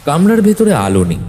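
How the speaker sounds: plain reading voice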